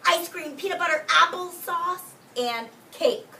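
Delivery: high, bright, loud food list; excited list. A narrator's reading. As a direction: savour each treat with delight.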